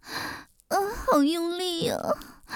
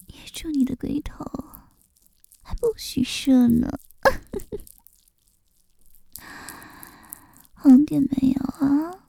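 teasing whisper; 4 dB louder than usual. Acted.

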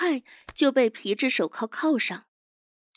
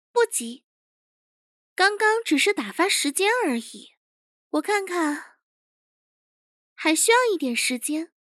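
higher-pitched, casually confident; higher young chief's voice, in no rush; unhurried interrogation chief; 6 semitones higher than usual. Acted. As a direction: stall the captive with calm assurance.

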